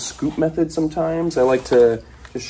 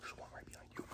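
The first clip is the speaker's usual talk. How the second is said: whisper; 32 dB quieter, much faster than usual. Real, not acted. Warning about a snake nearby.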